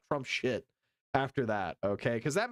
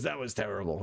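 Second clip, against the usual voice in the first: low lip-read voice; 8 semitones lower, faster than usual. Retold or imitated. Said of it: "He goes".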